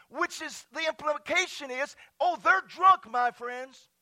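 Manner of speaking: high, loud preacher's proclamation; preaching at full force. Real, not acted. Pastor proclaims Peter's speech to church, fervent preaching. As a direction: declaim scripture loudly with rising fervor.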